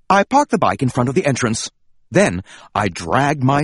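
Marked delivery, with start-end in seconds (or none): none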